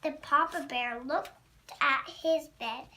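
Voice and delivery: ordinary delivery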